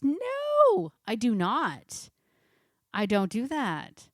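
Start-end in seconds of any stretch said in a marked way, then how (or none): 0.0-0.9 emphatic no. Real, not acted. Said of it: "Do I let my dogs play in the house?".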